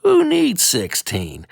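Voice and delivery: ordinary delivery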